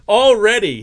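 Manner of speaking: a loud, high burst of disbelief; excited. Real, not acted. Card breaker thrilled that one player already has two autos, excited shout. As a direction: blurt it out, thrilled.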